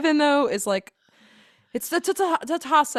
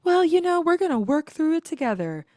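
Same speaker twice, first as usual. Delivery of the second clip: higher, louder sugary imitation of Becca; mocking Becca; 7 semitones higher, 4 dB louder than usual. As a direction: parody her sweet tone lightly. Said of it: "Becca sitting next to Garrett saying".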